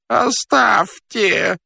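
long, high-pitched wail of protest; moaning. Acted. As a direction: groan it out, very drawn out. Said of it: "простонал он". (протяжный жалобный стон, высоко)

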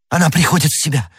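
quick, high-pitched cry; joyful exclamation. A narrator's reading. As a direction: exclaim it in sudden relief. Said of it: "воскликнул юноша". (быстрый радостный возглас)

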